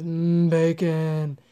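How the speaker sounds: slow, drawn-out "Mm, bacon" in a put-on voice; mock bacon lover. Meat eater taunting a vegan, slow savouring put-on voice. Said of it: "looks at every vegan and just goes".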